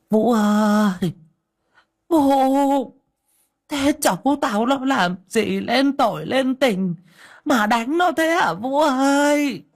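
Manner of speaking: high, slow anguished call; old woman crying out. Acted. Grandmother shouting at her daughter-in-law, anguished. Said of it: "bà gào lên".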